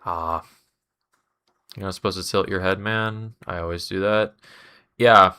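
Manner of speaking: talking with tissue up the nose; nose plugged with tissue; tissue up one nostril. Real, not acted. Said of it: "replace the tissue in my nose".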